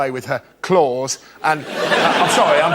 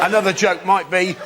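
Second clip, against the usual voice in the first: ordinary talk, nothing marked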